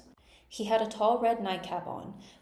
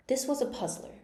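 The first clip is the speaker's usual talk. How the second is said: ordinary delivery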